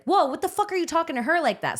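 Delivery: put-on high, startled outburst in the boyfriend's voice; retold outraged yell. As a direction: pitch jumps up in outrage. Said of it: "And Lewis was like".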